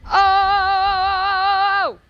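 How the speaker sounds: long, high, loud "oh"; high sustained holler; long loud yell. Real, not acted. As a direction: hold out a loud high cry. Yells out during game play.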